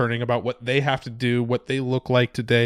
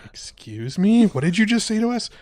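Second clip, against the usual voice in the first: higher, hammed-up shocked cop; mock offended surprise; 8 semitones higher than usual. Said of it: "And then they're like".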